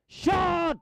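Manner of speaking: sudden very high cry; real football commentator's yelp; excited shot call. Real, not acted. Commentator calls a shot at goal.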